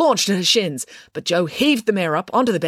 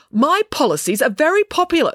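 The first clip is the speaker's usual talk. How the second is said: higher, louder defensive retort; defensively; 11 semitones higher, 5 dB louder than usual. A narrator's reading. Mayor defends herself against Loretta, defensively. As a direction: push back to protect her record. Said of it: "said the mayor defensively".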